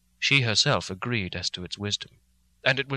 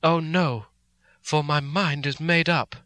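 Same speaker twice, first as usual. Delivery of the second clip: higher, firm and resolved; devoted martyr; 5 semitones higher, 3 dB louder, slower than usual. A narrator's reading. Mina answering Van Helsing with martyr's resolve. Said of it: "eyes shone with the devotion of a martyr".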